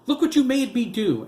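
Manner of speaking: high, loud blaming yell; mother yelling after throwing her son; furious yell. A narrator's reading. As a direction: yell it to shift the blame. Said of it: "Anna yelled".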